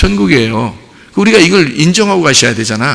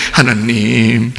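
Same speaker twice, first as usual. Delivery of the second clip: low, long-drawn reverent call; drawn-out, hammed-up piety; mock pious calling; 4 semitones lower, much slower than usual. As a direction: imitate a devout worshipper slowly calling out in prayer. Mimicking pious calling on God.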